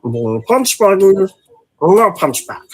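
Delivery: loud, high cartoon alien creature; Stitch voice. Streamer joking for partner and chat, loud Stitch voice. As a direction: call out the car game as a little alien. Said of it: "always doing the Stitch voice".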